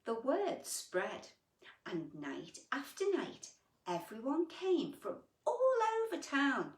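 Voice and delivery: storybook voice for little ones; gentle picture-book reading; reading to children. A narrator's reading. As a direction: read it like bedtime story time. Reads picture book aloud.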